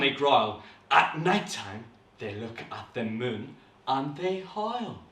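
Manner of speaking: put-on measured, dramatic reading to children; softly suspenseful storytelling; story narration. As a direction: tell the tale with deliberate pauses. Reads story aloud.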